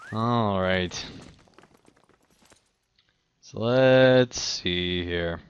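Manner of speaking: ordinary delivery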